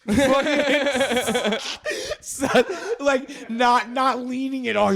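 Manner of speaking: high, loud laughing through words; cracking up mid-sentence. Real, not acted. Laughing hard at the story.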